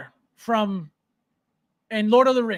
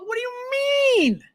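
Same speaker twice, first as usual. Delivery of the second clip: incredulous; 12 semitones higher than usual. Real, not acted. Mocking disbelief at chat.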